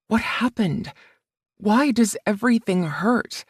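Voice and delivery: usual speaking voice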